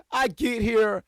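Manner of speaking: laughing high and slow through the words; laughing restart. Real, not acted. Restarting the story to viewers, laughing it out. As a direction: break up laughing as you start over. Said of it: "laughs, restarts the line".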